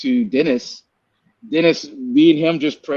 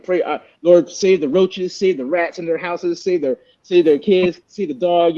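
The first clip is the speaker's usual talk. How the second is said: higher-pitched, rattled-off list of pleas; mock-pray through a reluctant list, pitched up; grudging mock prayer; 6 semitones higher than usual. Praying grudgingly for the men who wronged him, mock pleading.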